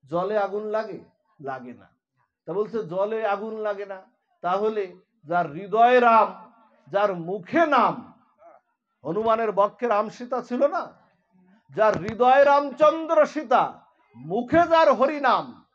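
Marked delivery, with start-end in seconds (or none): 4.4-15.6 louder preaching, each phrase pressed home; rousing sermon. Acted. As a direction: preach it with fervour to the listeners. Preacher raises voice on Ram verses. (উচ্চস্বরে প্রবচন)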